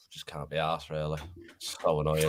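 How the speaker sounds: sighing, near-whispered, fed up; barely bothered, drained and low; weary, deflated mumble. Real, not acted. Gutted fan after cup exit.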